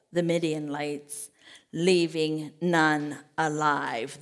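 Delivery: lightly voiced divine promise, unhurried; a hint of God. God promising Gideon victory, slow solemn voice.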